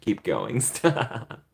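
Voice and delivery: lightly imitating a coy mom; teasing imitation broken by laughs; mock coy protest. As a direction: play-act a giggly "oh stop". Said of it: "Living Dead Mom's like".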